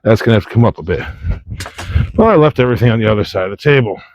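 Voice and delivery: ordinary talk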